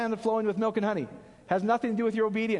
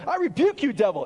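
loud, high hammed-up rebuke of the devil; mock rebuke shout; 5 semitones higher, 6 dB louder than usual. Pastor mocking Christians rebuking the devil, loud. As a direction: bark it like a showy deliverance prayer. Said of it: "Christians running and going".

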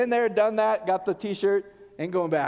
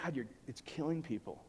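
hushed lament; 5 semitones lower, 12 dB quieter than usual. Retold or imitated. Quoted frustration to God.

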